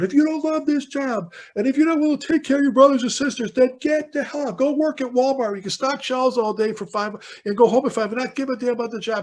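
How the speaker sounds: high, pushed preacher-style rant, building; fiery keynote rant. Retold or imitated. Firefighter telling a keynote crowd to love the job or leave, high and fiery. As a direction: preach it at the crowd like you mean it. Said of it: "I'm going".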